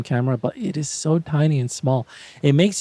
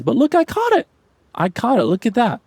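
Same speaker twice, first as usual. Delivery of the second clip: much higher, thrilled I caught it; real delight at the shot; excited; 10 semitones higher than usual. Real, not acted. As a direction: shout the win up high. Excited about catching butterfly.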